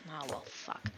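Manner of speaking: low, very soft grumble; resigned referee. Acted. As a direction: give in quietly, under the breath. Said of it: "soon the referee is kind of".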